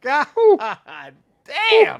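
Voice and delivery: loud and high, pausing between the words; blown-away shout; excited exclamation. Real, not acted. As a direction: holler it in two drawn-out bursts. Repeats the excited outburst.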